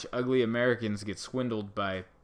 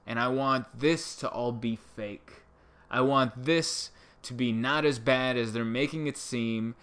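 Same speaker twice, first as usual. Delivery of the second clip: ordinary delivery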